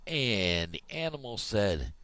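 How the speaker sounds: ordinary talk, nothing marked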